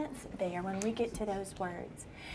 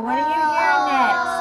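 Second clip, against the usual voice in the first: ordinary talk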